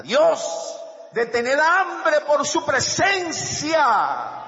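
high, very loud, drawn-out cry; impassioned preaching shout. Real, not acted. Preacher urging hunger for God's presence, impassioned shout. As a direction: swell into a stretched-out pulpit roar.

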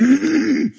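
theatrical throat-clear for attention; pointed throat-clearing. Acted. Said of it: "you're like".

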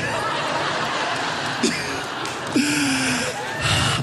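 high airy silent laugh; breathless laugh. Real, not acted. Comedian cracking up at his own story, airy laugh. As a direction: wheeze with laughter.

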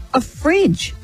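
normal talking voice

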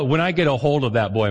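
ordinary talk

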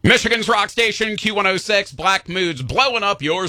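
high, bright oldies radio DJ; light take on a vintage radio DJ; sixties DJ mode. Shown as a demonstration. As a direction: sell the station ID like a sixties jock. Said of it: "I slide into, like, nineteen sixties D J mode".

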